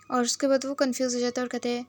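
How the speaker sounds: ordinary delivery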